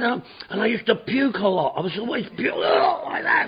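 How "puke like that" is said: laughing puking imitation. Real, not acted.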